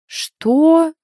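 loud, high, outraged question; indignant. A narrator's reading. Friend objecting to the heroine's excuse, indignant. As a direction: shoot it back in indignation. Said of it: "возмущённо переспросила Вика".

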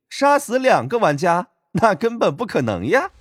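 high, coy voice with a giggle; sly giggle. Acted. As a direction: giggle behind a hand, playful. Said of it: "捂着嘴巴偷笑道".

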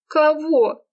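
high, wailed question; tearful wail. A narrator's reading. Serafima mid-sobbing questions her friend, wailing. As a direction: wail it in high-pitched bewilderment.

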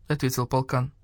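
plain reading voice

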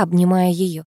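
ordinary delivery, nothing marked

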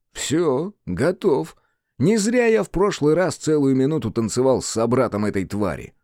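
ordinary delivery

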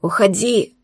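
wet gurgling shout. A narrator's reading. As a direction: shout through blood in the throat. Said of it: "закричал булькающим голосом Арман".

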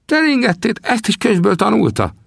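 high teasing exclamation; mocking old trapper. Sam mocks the greenhorn's book knowledge in a high old voice. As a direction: tease him about his book-learning, high. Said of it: "Eltalálta Sam".

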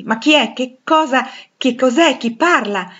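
high, loud, startled questions; agitated demand. Acted. Blind Rochester hearing an unexpected voice, agitated and demanding. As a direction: fire off urgent, bewildered questions.

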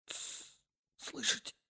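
breathy hush; whisper. Acted. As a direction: whisper urgently. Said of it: "прошептал молодой человек".

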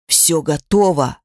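low, slow demon rumble; rumbling demon. A narrator's reading. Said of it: "пророкотал Кайтев". (низкий рокочущий голос демона)

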